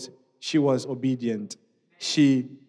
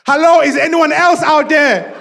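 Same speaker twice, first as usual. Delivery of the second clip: high, loud, drawn-out call; comic desperate yell into the distance; screaming for help; 12 semitones higher, 12 dB louder than usual. Acted. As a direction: shout past God for another rescuer. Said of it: "Jack was screaming".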